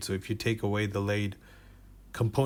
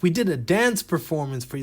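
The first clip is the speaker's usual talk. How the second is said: higher, louder proud announcement; mock descendants; 8 semitones higher, 6 dB louder than usual. Descendants proudly tell ancestors about a dance, higher and louder. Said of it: "And they're like".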